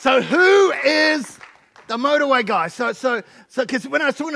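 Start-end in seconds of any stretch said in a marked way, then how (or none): 0.0-2.7 high, loud, drawn-out build-up; big introduction. Real, not acted. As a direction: stretch it out like a show host. Host builds up the guest's introduction.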